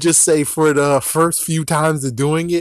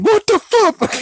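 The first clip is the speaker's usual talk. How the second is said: very high, louder shocked cry; shocked exclamation; 4 dB louder, slower than usual. Retold or imitated. A bystander seeing a gun appear, shrieking very high in shock. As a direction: squeal it in total disbelief. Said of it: "it's like".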